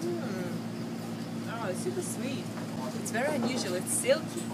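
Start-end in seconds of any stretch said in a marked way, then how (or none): none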